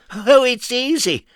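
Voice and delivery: very high squeaky Mister Nonsense; high silly voice. Acted. Narrator voicing Mister Nonsense, high and squeaky. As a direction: pipe it up like a daft little man.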